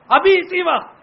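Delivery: high, loud commanding burst; angry order. Acted. Tyrant ordering the executioner, loud angry command. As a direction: bark the order to kill now.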